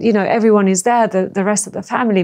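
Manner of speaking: normal talking voice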